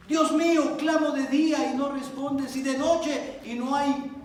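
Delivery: high, loud pleading psalm; impassioned loud reading. Acted. Preacher reading the psalmist's complaint, loud. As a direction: plead with God.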